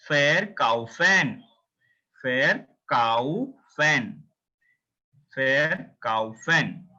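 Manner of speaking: fair cow fan drawn out slowly, V as F; slow syllable drill. Shown as a demonstration. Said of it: "try to read the first three character".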